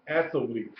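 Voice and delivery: "athlete" in three syllables, ath-uh-lete; fully pronounced. Shown as a demonstration. Teacher repeating "athlete" for the class, fully pronounced. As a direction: slip a vowel between th and l in "athlete". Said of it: "pronounce it all the way out".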